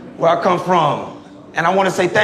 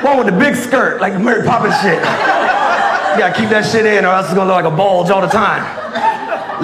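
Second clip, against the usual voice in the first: ordinary delivery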